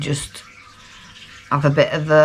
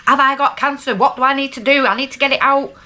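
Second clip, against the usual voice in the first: frantic rush of questions; 9 semitones higher than usual. Retold or imitated. Retelling how she burst in on the surgeon, mock panicky. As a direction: fire the questions off in a flap. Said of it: "and you were just".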